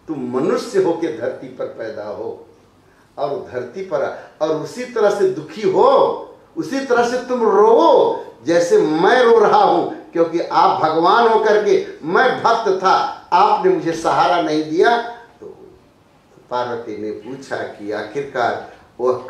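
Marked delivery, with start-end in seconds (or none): 4.4-15.0 anguished curse. Acted. Narad cursing Vishnu in anger.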